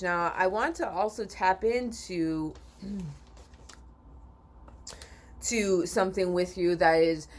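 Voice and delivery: normal talking voice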